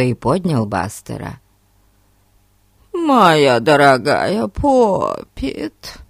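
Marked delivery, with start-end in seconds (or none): 2.9-6.0 prissy, slow mock lady; affected lady voice. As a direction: mimic a simpering rich lady. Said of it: "жеманным голосом сказал он, передразнивая миссис Лоренцо".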